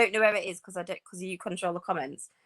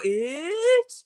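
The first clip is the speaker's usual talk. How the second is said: mocking "It," pitched up high and loud; mock Northeast it; 9 semitones higher, 6 dB louder than usual. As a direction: imitate the Blackpool lot teasing her "it". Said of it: "they all go".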